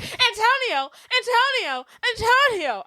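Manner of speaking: high, swooning call of his name; dramatic calling out. Retold or imitated. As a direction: sigh his name like a lovesick heroine. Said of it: "She was like".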